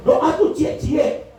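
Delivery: slow, high and stretched; drawn-out emphasis. Real, not acted. Preacher drawing out the words to tell parents to pray in detail. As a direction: stretch each word out for emphasis. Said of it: "stretched emphasis".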